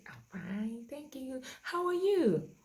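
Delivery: put-on reply as a polite child, pitch climbing; child answering. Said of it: "the child will say".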